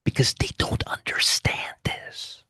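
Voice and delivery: breathy whisper, slowed down; whispered aside. Real, not acted. A whispered jab at gurus to students. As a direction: let it slip out as a secret whisper.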